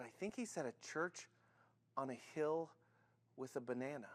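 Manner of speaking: soft, halting attempt; hushed, uncertain, with pauses; hesitant guess. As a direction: puzzle it out quietly, piece by piece. Puzzled kid guesses softly.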